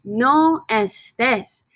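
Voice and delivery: "No estés" said very slowly; slow model. Shown as a demonstration. Teacher modelling "No estés" slowly for the class. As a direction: spell out "No estés" syllable by syllable. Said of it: "There's an accent on this E".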